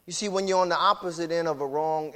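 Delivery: usual speaking voice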